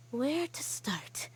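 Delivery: slow whispered musing; slow whisper, gathering her thoughts; muttered to herself. Acted. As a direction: think aloud under your breath. Muttering to herself, still groggy.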